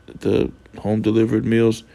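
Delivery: normal talking voice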